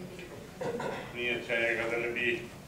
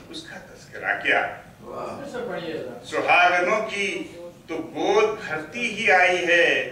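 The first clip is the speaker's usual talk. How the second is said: declaimed Urdu verse, deliberate pauses between phrases; poetry recital; 2 semitones higher, 7 dB louder, slower than usual. Acted. Poet reciting to an appreciative mushaira audience, poetry recital. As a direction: swell on repeated lines, ease down on the refrain.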